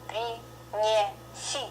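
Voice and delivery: принеси slowly split as при-не-си, all soft; soft syllable by syllable. Shown as a demonstration. Teacher breaks it down for learners, slow soft syllables. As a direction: stretch принеси into three softened syllables. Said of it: "all of them very soft".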